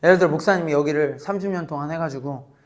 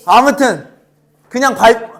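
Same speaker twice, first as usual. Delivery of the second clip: usual speaking voice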